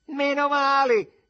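very high, loud, drawn-out cry; mother yelling. A narrator's reading. Mother answers the neighbour's insults, shouting. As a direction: shriek it out slowly. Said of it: "gridò mettendosi una mano sul fianco".

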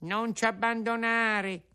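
high, pleading whine; sly fox begging in a whimper; whining fox. Acted. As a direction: beg pitifully, fox style.

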